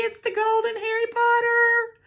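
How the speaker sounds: very high, gleeful squeal; real squeal. Real, not acted. Showing off the long-sought figure, squealing. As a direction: let it rise into a sustained squeal. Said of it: "now that I've squealed".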